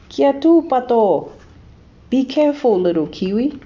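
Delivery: high, loud stop-and-be-careful call; urgent warning. A narrator's reading.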